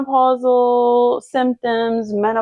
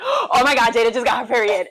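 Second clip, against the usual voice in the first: mock excited mom; 3 semitones higher than usual. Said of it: "She's like, gasps".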